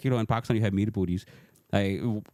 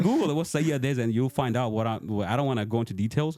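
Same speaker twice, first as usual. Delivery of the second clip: ordinary talk, nothing marked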